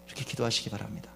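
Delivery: ordinary talk, nothing marked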